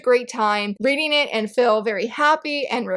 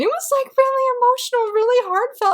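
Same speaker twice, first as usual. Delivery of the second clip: emotional gush; 12 semitones higher than usual. Real, not acted. Fan telling viewers about a heartfelt backstory, emotional and high. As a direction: let it swell up high with feeling. Describing emotional volume three.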